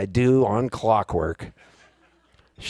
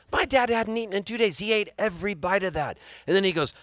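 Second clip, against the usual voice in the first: usual speaking voice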